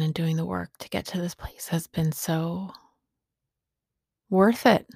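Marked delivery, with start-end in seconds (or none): none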